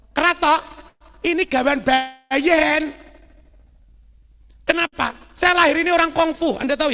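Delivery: loud, high-pitched punchy delivery; loud natural voice. Shown as a demonstration. Speaker showing listeners his inborn loud talking, loud and emphatic. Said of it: "Saya kalau ngomong kan keras toh".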